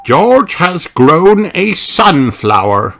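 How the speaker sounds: high, slow grandfatherly voice; proud grandpa telling; Grandpa Pig voice. Acted.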